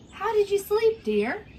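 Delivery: high, sugary queen asking; lightly voiced queen, lilting up; queen politely asking a guest how she slept; sweet queen voice. Queen asks princess.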